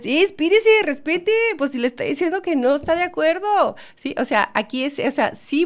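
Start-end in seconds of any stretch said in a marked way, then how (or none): 0.0-3.8 indignant retort. Retold or imitated. Retorting to teasing relative.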